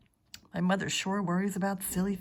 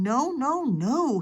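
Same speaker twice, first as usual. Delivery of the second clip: loud, high no-no-no; hollering toddler; 7 semitones higher, 6 dB louder, much slower than usual. Acted. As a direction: yell it like a small boy digging in. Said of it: "he hollered".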